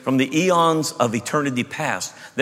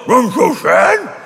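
high, very slow pitiful pet voice; be the mopey dog; sad dog voice; 10 semitones higher, 6 dB louder, much slower than usual. Pretend. Dog speaking to its owner, mock pitiful whine. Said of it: "Did your dog look at you and go".

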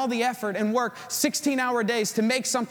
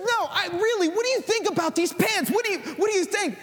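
high, insistent mock wife voice; imitating wife; 10 semitones higher than usual. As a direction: press him for an opinion, pitched way up. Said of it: "She, she's like".

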